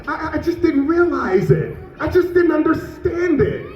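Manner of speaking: high-pitched, hammed-up astonishment; exaggerated stammering disbelief; mock amazement. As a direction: play baffled, voice riding high. Playfully amazed at children's answers.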